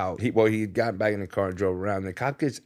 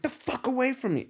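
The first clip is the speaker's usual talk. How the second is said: much higher, threatening yell; angry warning; 13 semitones higher, 4 dB quieter than usual. Retold or imitated. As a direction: warn them off holding a rock. Threatening with a rock.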